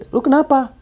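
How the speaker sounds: high, urgent call on arrival after running; running up to a hurt friend, alarmed; alarmed question. Retold or imitated. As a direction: ask in alarm, out of the rush. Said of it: "Gue gituin kan".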